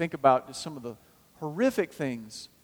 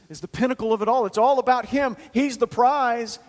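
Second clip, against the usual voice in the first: higher and louder, slowing on the prize; preaching climax; 8 semitones higher, 6 dB louder than usual. Acted. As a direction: lift it to the peak.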